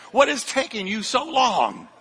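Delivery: high-pitched, exasperated mock complaint; exasperated rant. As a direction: vent at the workers in mock frustration. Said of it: "I have talks with those construction workers".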